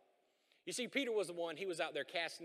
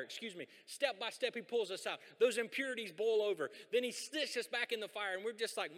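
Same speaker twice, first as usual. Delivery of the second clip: ordinary talk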